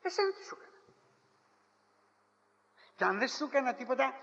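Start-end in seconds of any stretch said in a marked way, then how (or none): 0.0-0.8 much higher plaintive question; hurt plea, pitched way up; pleading question. Acted. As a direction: rise up high in hurt pleading. The Lord asks the people.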